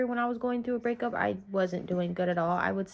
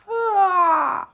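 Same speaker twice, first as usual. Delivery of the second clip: high, loud "ah"; sudden high wordless outburst; loud cry out; 11 semitones higher, 11 dB louder than usual. Real, not acted. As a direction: let out a big high shout of "ah". Cries out mid life update.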